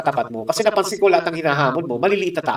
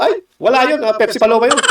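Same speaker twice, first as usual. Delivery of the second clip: higher, scoffing bystander voice; dismissive gossip; 7 semitones higher, 3 dB louder than usual. Retold or imitated. A bystander brushing off the senator, scoffing. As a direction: wave him off with a sneer. Imitating people's dismissive answers.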